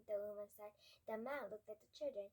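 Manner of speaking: plain reading voice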